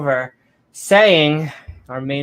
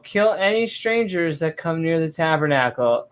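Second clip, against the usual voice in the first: ordinary talk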